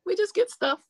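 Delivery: put-on high-pitched little girl voice; imitating young daughter. Daughter shrugging off her mom's fame, imitated child voice. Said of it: "she's just like".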